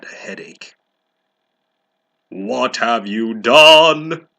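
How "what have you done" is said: much louder and slow, hammed-up grave; booming. Dumbledore confronts Ebony, hammed-up slow booming. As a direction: thunder it like an angry headmaster. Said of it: "he started to cry wisely".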